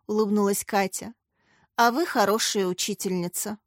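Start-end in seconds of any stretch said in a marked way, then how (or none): none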